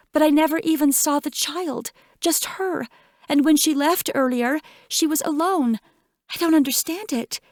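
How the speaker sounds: high, upset tearful rush; crying, words spilling out. A narrator's reading. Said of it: "her best friend crying in the bathroom".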